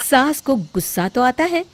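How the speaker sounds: ordinary delivery, nothing marked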